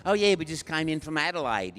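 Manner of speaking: put-on broad Australian young woman; pronounced Australian accent. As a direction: imitate a local with thick Australian vowels. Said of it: "she was really talking like that".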